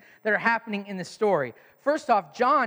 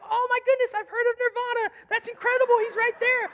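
high, gushing and thrilled; suddenly starstruck; high excited fans; friends getting excited. Retold or imitated. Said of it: "then they got excited".

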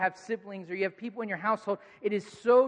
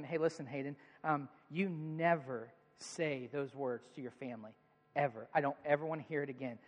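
low, soft, stern talking-to; quiet, firm parent; 6 semitones lower, 9 dB quieter than usual. Retold or imitated. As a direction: lay down the rule softly to a child. Dad sternly calming his son.

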